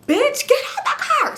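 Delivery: acted-out screamed order; retold screaming. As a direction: scream it as a storyteller, voice shooting way up. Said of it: "So I started screaming".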